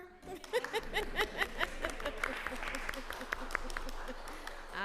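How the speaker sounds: high, long peal of real laughter. Real, not acted. Witness amused by a panel member's question, long laugh. Amused reaction to being complimented.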